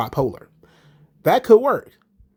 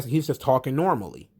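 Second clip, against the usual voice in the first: ordinary talk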